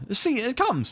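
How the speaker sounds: put-on high, eager boy's call; pointing boy. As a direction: point up and tell the others. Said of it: "said Curly, pointing".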